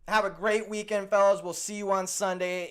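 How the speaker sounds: high, loud cheerful goodbye; excited sign-off. Real, not acted. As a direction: belt the goodbye out brightly. Second, louder sign-off.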